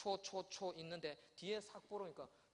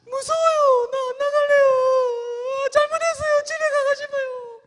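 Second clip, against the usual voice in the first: put-on high, loud, slow sobbing plea of a terrified child; crying child pleading; 17 dB louder, slower than usual. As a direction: beg through tears like a frightened kid. Scared child pleading with teacher.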